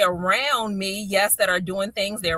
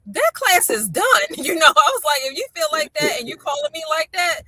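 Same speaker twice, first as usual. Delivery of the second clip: high-pitched, animated putting the foot down; emphatic insistence; 7 semitones higher, 4 dB louder than usual. Retold or imitated. Mother pulling her upset son out of class, emphatic insistence. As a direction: lay down the verdict with a laugh in it.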